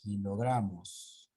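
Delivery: slow "kilogramos", weight on gra; careful, drawn-out model of "kilogramos" stressed on gra. Shown as a demonstration. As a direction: say "kilogramos" slowly, leaning on the GRA. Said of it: "La mayor fuerza de voz está en la gra".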